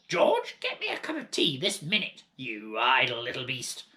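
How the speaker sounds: grumpy grandma demanding tea; bossy grandma. Acted. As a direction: snap orders like a spoilt old tyrant. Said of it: "she yelled".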